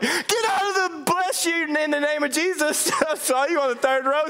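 high, laughing snap that swerves into a blessing; a hammed-up angry driver turning pious; mock road rage. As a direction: play an angry driver who catches himself. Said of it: "You're like".

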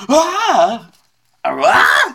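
short, loud, high screams; startled scream. Acted. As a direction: scream as if suddenly grabbed. Game master voices grabbed passer-by.

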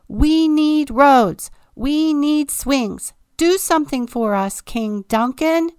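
furious subjects yelling at the king; crowd shouting. A narrator's reading. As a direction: shout it like a crowd storming the castle. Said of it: "they shouted".

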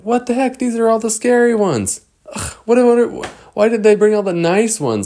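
high, loud indignant outcry; shocked outcry. Acted. Kids reading the dinosaur list, high shocked outcry. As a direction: protest in disbelief.

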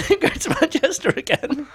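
high squeaky laugh through words. Real, not acted. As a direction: giggle the words out. Laughing while telling.

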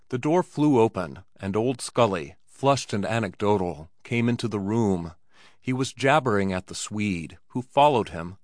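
ordinary delivery

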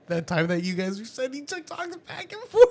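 laughing disbelief. Retold or imitated. Retold shocked question, laughing.

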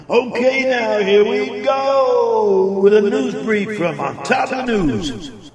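slow drawn-out announcer voice; announcer intro. Acted. Host introducing his news brief, slow announcer read. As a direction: stretch it like a radio jingle.

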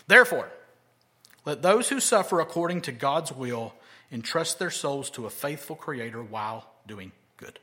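usual speaking voice

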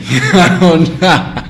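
loud, high, laughing admission; words stretched through laughter. Real, not acted. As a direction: crack up at your own bad attempt. Laughing at own accent attempt.

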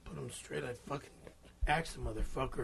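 very quiet, low grumble; low muttered threat. Real, not acted. Grumbling about confronting the guy, low muttered threat. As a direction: mutter it darkly under your breath.

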